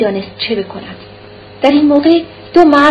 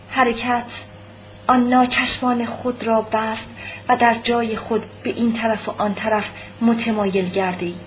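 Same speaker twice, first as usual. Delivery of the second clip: ordinary delivery, nothing marked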